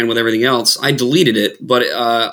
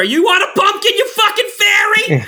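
much higher, far-off worked-up comic voice; Bill Burr. Retold or imitated. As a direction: rant like a fired-up Boston comedian. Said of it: "you think of, like, Bill Burr".

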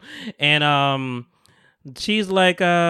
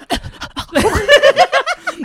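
squealing laughter; high laugh; 5 dB louder than usual. Real, not acted. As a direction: crack up loudly. Laughing at story.

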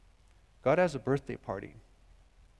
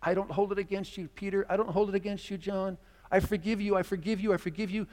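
higher, fervent pleading forgiveness; Jesus forgiving Peter and John, higher and fervent; earnest forgiveness; 6 semitones higher than usual. Acted. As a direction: insist on forgiving each one. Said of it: "Jesus' response to them".

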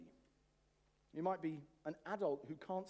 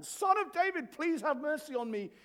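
much higher, loud desperate cry; blind man crying out; 11 semitones higher, 10 dB louder than usual. Acted. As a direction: shout it out over the crowd like the blind man. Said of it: "like this blind man".

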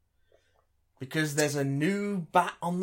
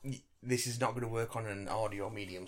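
ordinary talk

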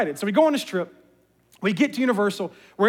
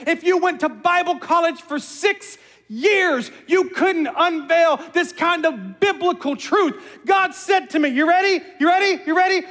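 building sermon climax; 5 semitones higher than usual. Acted. Preacher teases the congregation before the big reveal, climbing to a shout. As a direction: build the suspense like a preacher on fire.

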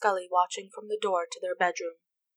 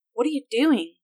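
sharp, louder, higher demand; a raised, accusing what are you doing; angry snap; 5 semitones higher, 6 dB louder than usual. A narrator's reading. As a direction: snap at her, caught off guard. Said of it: "Amy snapped".

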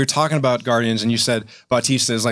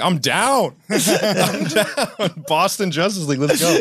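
excited; 6 semitones higher, much slower than usual. Retold or imitated. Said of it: "I was like".

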